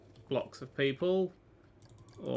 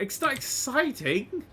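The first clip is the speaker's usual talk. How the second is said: high, slow, outraged disbelief; indignant surprise; 9 semitones higher, slower than usual. Real, not acted. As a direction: protest the rating in mock outrage. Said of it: "Oh, what's that?".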